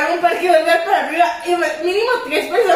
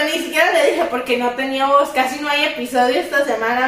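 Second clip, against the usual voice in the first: ordinary talk, nothing marked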